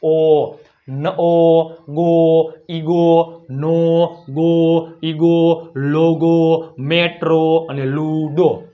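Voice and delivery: "ગો, ઈગો, નો" slow, ending on a held O; final O demonstration. Shown as a demonstration. Teacher drilling O at word end, "ઈગો" with long final O. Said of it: "શું ઉચ્ચાર થાય?".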